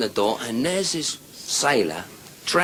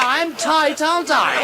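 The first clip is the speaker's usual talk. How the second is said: very high squealed boast; loud, high punchline; triumphant woman; 4 dB louder than usual. Acted. Woman boasting to her lover, high squeal.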